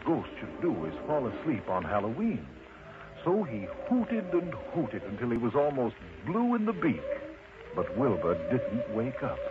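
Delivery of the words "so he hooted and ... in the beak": ordinary delivery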